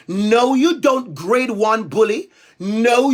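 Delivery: ordinary delivery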